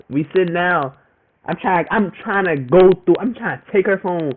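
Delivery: normal talking voice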